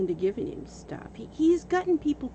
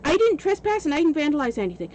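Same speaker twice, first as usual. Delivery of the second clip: higher, louder, fast guilty denial; mock defensive protest; 6 semitones higher, 7 dB louder, faster than usual. The trespasser defending himself, mock protest. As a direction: blurt it out like a caught liar.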